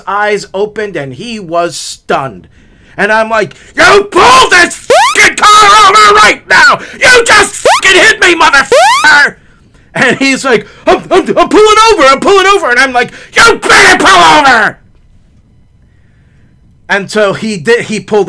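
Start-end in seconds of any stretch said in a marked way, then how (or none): 3.7-9.4 very high, loud enraged shouting; furious yelling. Retold or imitated. Hit driver confronting the man who fled, furious yelling. 10.9-12.7 high, loud, stammering panic; panicked compliance. Retold or imitated. Stunned older driver answering a yelling man, panicked. 13.3-14.8 loud, high threatening shout; angry yelling. Retold or imitated. Hit driver ordering the other man over, angry yelling.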